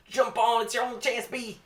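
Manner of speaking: very high, loud call to jump; urgent yell. Acted. Mosquito urging Barry onto the wiper, high and loud. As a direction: scream the rescue order.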